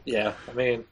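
usual speaking voice

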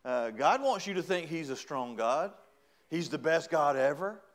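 ordinary delivery

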